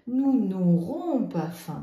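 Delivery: slow, loud "Nous n'aurons pas faim"; teacher voice, slow and loud; slow model sentence. Shown as a demonstration. Teacher modelling the correct negative for pupils. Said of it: "je mets le N apostrophe".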